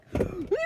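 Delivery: loud, high gasp; panicked gasp. Retold or imitated. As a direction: gasp before a scream. Panicking in retold labor.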